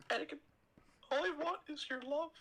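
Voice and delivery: high breathy falsetto Padme, swooning; hammed-up high Padme voice. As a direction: squeal her name in a high falsetto. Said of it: "my Padme is unmatched".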